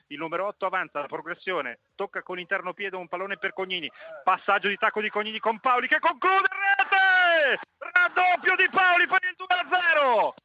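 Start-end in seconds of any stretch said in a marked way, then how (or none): 4.2-10.3 high, loud goal scream; breathless italian goal commentary. Real, not acted. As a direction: roar the second goal. Second goal scored.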